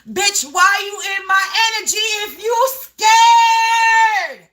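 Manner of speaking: slow, high sung-out shout; yelling. Real, not acted. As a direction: belt it out at them, drawn long. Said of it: "Sorry, collective, for yelling".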